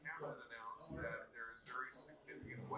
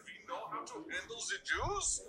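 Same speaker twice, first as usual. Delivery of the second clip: put-on thick German accent, pitched higher; 8 semitones higher, 4 dB louder than usual. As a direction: imitate a heavy German accent. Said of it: "with her very thick German accent to be like".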